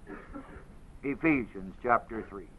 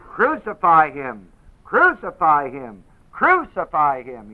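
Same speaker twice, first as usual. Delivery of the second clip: loud, slow, chanting demand; crowd crying out; 2 semitones higher, 9 dB louder, slower than usual. Retold or imitated. Mob demands crucifixion, loud slow chant. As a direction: pound out each crucify. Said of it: "they cried".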